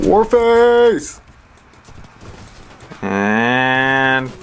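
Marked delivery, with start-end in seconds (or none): 0.0-1.2 a loud, high hyped call; announcer shout. Acted. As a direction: shout the title like a game announcer. Announcing the game name.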